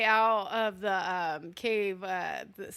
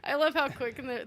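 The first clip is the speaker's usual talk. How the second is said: usual speaking voice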